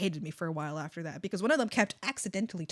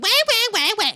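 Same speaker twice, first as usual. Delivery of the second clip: high, loud crybaby wail; mock someone's whining to their face; exaggerated baby wah-wahs; 12 semitones higher, 9 dB louder than usual. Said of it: "You whiny".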